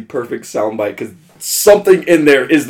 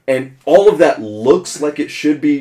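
usual speaking voice

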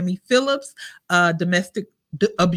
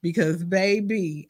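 ordinary talk

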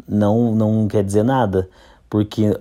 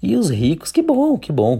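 sarcastic; 10 semitones higher than usual. Real, not acted. Mock praise of the rich.

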